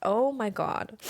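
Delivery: usual speaking voice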